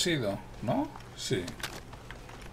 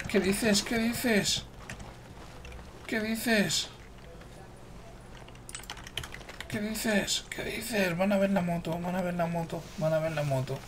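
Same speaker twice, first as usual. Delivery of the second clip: high, thin put-on disbelief; playful disbelief; 8 semitones higher, 5 dB quieter, slower than usual. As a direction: repeat it high and softly, as if baffled. Mock disbelief at enemies seeing bike.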